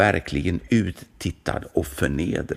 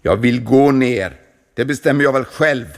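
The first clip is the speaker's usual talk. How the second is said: defiant; 5 semitones higher, 7 dB louder than usual. A narrator's reading. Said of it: "svarade munken".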